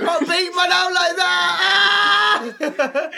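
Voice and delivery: loud laughing protest; shouting. Real, not acted. Caught out by friends' teasing.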